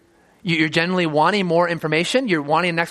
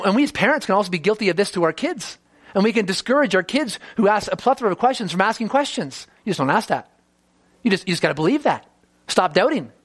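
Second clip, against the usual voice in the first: normal talking voice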